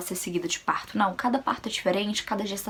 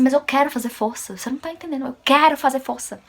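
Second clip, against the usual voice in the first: raised, insistent outburst, loud on the demand; losing her temper; 5 semitones higher, 6 dB louder than usual. Retold or imitated. Labouring patient arguing with the staff, losing her temper. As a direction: insist hotly, pitched up. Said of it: "foi a primeira vez que eu me, tipo assim, me alterei".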